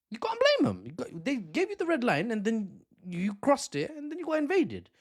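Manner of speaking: squeaky-high, incredulous argument; high-pitched protest. Real, not acted. Host arguing they cannot be blamed, high-pitched protest. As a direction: shoot the voice way up in protest. Host exclaims in defence of the reaction.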